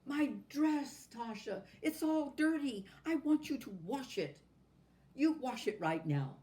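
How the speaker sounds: high, loud shrieking demands; a spoiled doll throwing a fit; screaming tantrum. A narrator's reading. Doll screaming at Natasha about her dirty dress. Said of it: "started to scream".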